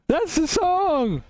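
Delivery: sing-song the line way up high; a hammed-up high announcement; high drawn-out repeat.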